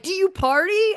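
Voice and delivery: high, teasing question; mock eager fan. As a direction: lightly imitate a nosy fan, voice jumping up. Imitating people asking her.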